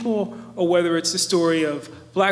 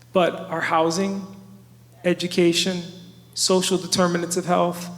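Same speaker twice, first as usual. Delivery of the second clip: normal talking voice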